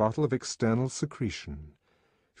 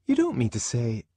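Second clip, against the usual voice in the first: raised, startled woman's voice; higher, taken-aback protest; 4 semitones higher, 4 dB louder than usual. A narrator's reading. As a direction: break off in mild shock. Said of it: "Fanny looked startled".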